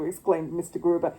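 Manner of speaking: usual speaking voice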